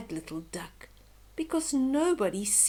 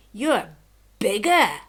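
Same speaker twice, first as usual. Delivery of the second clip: haughty laugh; 3 semitones lower, 7 dB louder than usual. Acted.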